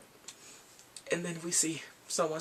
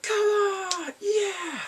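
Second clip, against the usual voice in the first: very high, loud mock cheer; hammed-up bratty whoop; spoiled brat cheer; 4 dB louder, much slower than usual. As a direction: yell it like a bratty rich kid. He's like.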